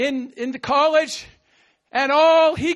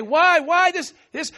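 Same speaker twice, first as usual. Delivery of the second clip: ordinary talk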